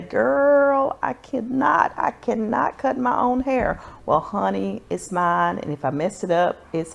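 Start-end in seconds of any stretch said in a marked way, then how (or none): 0.0-3.8 higher, louder mock doubtful viewer; imitated viewer. Vlogger mimics her viewer's hesitation, higher and louder. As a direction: play the hesitant viewer who can't cut hair. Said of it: "And you say".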